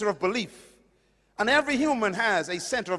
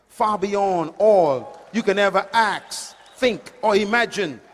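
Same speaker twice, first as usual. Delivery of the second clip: scripture recitation; 2 semitones lower, 5 dB louder than usual. Acted. Preacher reciting the verse his mother gave, building loud.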